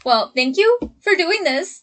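ordinary talk, nothing marked

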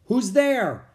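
loud, high-pitched demand; shouted. A narrator's reading. Captain demanding an answer from the dark, shouted. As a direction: shout a challenge at the hidden intruders. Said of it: "the captain shouted again".

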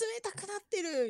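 mock shock, pitched high; a touch of dismay. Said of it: "みたいなことになるんでしょ？".

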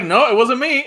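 loud mock denial. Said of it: "he was like".

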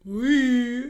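annoyed squeak. Retold or imitated. Imitating uncle's annoyed noise, high squeak. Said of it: "he makes this sound".

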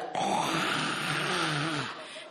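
long noisy gush of breath; huge smoke exhale. Shown as a demonstration. Comic showing the dab's smoke, huge exhale. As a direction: exhale an endless cloud of smoke. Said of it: "but it was like".

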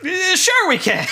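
very high, drawn-out comic retort; imitated comeback. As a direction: squeal the punchline slowly, close to laughing. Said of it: "turns back at John Lovitz".

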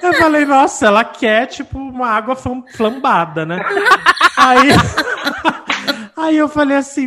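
words broken by laughter; can't stop laughing mid-story; laughing while telling. Real, not acted. (falando rindo)

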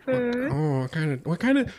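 high, hesitant actor impression; Owen Wilson. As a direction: stammer to a pal in a high put-on voice. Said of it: "I'll be Owen Wilson".

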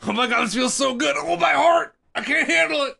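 high, loud overwhelmed moaning; ecstatic high voice. Pretend. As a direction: go high and breathless with pleasure. Voicing the dying man.

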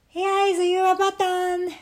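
high-pitched Frog voice; high, level storybook character voice; helpful Frog. A narrator's reading. Frog offers Toad another button, high and helpful.

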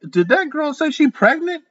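high, gasping disbelief; shocked friend. A narrator's reading. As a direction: ask it high and stunned. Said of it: "she gasped".

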